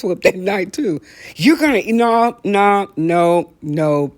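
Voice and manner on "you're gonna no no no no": loud refusal. Retold or imitated. Recalling refusing aunt's patty.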